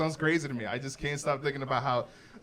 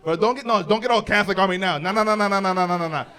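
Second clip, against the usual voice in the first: louder, higher rapid no no no; loud protest; 4 semitones higher, 7 dB louder than usual. Real, not acted. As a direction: wave off the shocked crowd.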